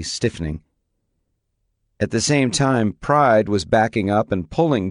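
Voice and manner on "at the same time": usual speaking voice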